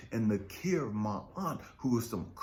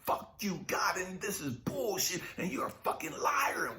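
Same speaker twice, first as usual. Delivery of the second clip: angry quote; 8 semitones higher than usual. Retold or imitated. Retelling what he shouted at God, mock angry. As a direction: spit it at God. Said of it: "I had no problems saying".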